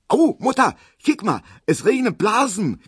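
put-on very high, loud child squeal; piercing high Berlin kid voice; squealing girl. A narrator's reading. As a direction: squeal it to mother in excitement. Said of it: "quietscht Erna".

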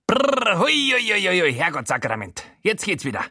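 high shuddering brrr and huis, then recovering; shaking it off. Acted. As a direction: shake yourself back to life. Showalter shakes off a fit.

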